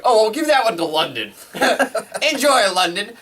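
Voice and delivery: high, loud, laughing sarcastic offer; mock the league handing over a dud game. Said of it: "They go".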